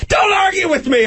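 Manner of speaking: very high mock shout; hammed-up shout. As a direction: scream it like an unhinged sergeant. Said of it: "I would yell".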